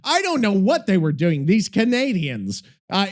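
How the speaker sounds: ordinary delivery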